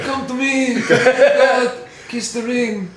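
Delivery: mafia don. Acted. Mocking the ring kissing as a mafia don, mafia voice. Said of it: "It sounds like a mafia don".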